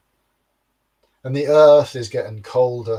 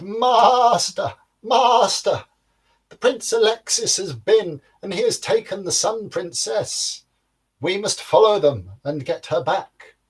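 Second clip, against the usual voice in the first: high, eager little goat voice; goat's voice; 7 semitones higher than usual. Acted. Servant goat to his giant master, high and eager. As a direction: squeak it out like an excited servant goat.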